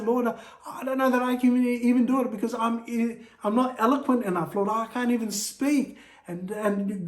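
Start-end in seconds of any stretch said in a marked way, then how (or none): 0.0-6.0 higher, halting plea of inadequacy; stammering Moses. Acted. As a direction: stumble through the excuse in a raised, worried voice. Moses voiced making excuses.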